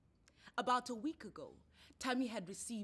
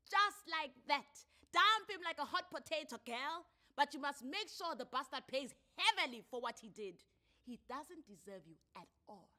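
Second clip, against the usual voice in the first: sassy bystander woman; 6 semitones higher, 6 dB louder than usual. A narrator's reading. Lady snaps fingers, advising.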